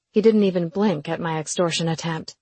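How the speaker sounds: usual speaking voice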